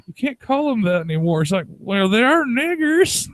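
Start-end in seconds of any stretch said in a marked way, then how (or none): 0.1-1.4 higher voice of a protesting granddaughter; young girl. Retold or imitated. Granddaughter scolding her grandma, lightly voiced young girl. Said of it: "the young girl, she's like". 1.7-3.3 put-on very high, slow old-woman voice; old granny. Granny arguing back at her granddaughter, old-woman voice. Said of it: "this old, like, old-ass woman".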